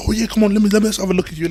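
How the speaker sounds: high, loud hearty greeting; delighted welcome. Retold or imitated. Gym owner Chris greeting the skinny kid, delighted.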